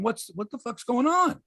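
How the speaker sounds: high-pitched mock bewilderment; bewildered outrage. Traded player phoning around for answers, imitated bewilderment. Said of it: "he's calling around going".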